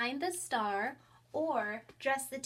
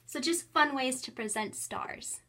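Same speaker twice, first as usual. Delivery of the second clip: ordinary delivery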